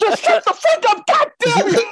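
high, hammed-up outburst; mock frantic yell. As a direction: snap it out way up high. Voicing himself yelling at wife.

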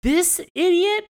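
high, loud, drawn-out disbelief; incredulous high-pitched mock cry; mock outrage. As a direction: exclaim it slowly in comic indignation. Acting out his baffled reaction.

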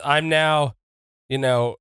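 normal talking voice

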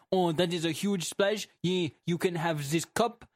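mock French presenter awarding a cup; French accent. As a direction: put on a silly French accent. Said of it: "and the potato goes".

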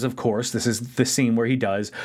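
usual speaking voice

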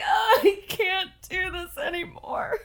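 high, laughing fake sob; laughing mock cry. Retelling editing her recordings, high laughing mock cry. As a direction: whimper it through laughter. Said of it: "you could hear me just crying".

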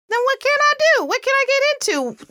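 put-on high-pitched, keen little kid; eager small child. Child asking parent for an alternative, high and eager. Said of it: "Your sugar wants to know".

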